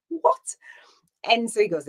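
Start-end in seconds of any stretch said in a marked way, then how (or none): 0.1-0.6 much higher, breathy whispered what; floored, barely able to speak; stunned whisper. Retold or imitated. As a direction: gasp it out in disbelief. Stunned at his world hiking.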